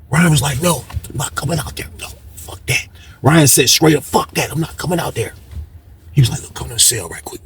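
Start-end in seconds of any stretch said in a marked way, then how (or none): 0.6-2.9 soft, fading to a near-whisper; imitated quiet refusal. Ryan refuses from his cell. 4.0-5.3 soft, muted voice of a man refusing; imitated quiet refusal. Ryan's refusal retold again. 6.4-7.4 hushed, lower-pitched aside; imitated discreet request. Sean discreetly summons him.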